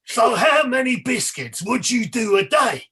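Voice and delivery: loud, high mock presenter boom; over-loud, dim-witted TV host parody. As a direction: belt out the question like he's had a head injury. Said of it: "in a much louder and like".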